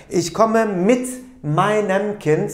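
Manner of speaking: "Ich komme mit meinem Kind" said slowly, each word set apart; slow model sentence. Shown as a demonstration. Teacher giving the German answer to the class, slow and deliberate. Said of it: "olursam ne diyeceğim?".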